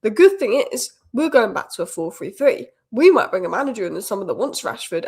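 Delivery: usual speaking voice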